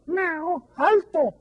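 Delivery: high cat voice meowing the word; cat meowing stop. A narrator's reading. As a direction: mew it like a cat. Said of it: "maulló el gato".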